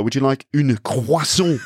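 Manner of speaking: put-on slow, showy French accent on croissant; pretentious French accent. Offering a croissant pretentiously, showy French accent. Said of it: "I'd probably be pretentious and say".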